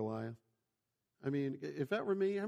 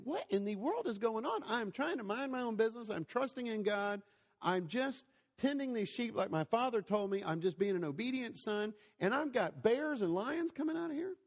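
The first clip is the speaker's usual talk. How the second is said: put-on higher, baffled young shepherd protesting; exasperated David; 7 semitones higher than usual. David complaining to God about bears, higher and exasperated. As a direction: throw up your hands as David, pitched up. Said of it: "can you imagine David thinking".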